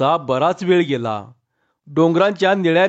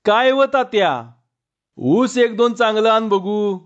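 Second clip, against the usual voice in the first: put-on girl answering the call, pitched much higher and carrying; calling back loudly; 6 semitones higher than usual. A narrator's reading. Girl calls back to her father from the cane, raised voice. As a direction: shout back across the sugarcane. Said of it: "तेवढ्याच जोरानं विचारलं".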